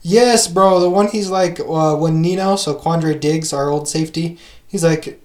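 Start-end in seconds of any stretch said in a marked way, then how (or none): none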